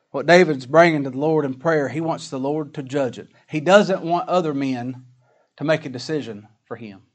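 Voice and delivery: ordinary talk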